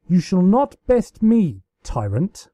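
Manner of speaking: loud, slow, grand declaration; hammed-up royal battle cry; mock-heroic defiance. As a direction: play the valiant rebel against a tyrant.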